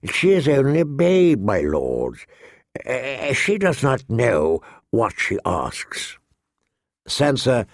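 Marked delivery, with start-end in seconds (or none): 0.0-6.2 sighing courtier. A narrator's reading. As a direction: plead gently for the child, with a sigh. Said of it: "sighed Varys".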